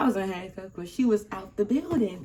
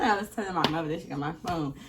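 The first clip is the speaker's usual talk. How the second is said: ordinary talk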